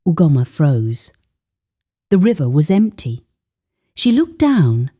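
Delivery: plain reading voice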